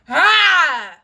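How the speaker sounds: full open-throated scream ah; releasing scream for viewers to join; cathartic scream. Real, not acted. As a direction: let it all tear out in one yell. Leading a scream release exercise.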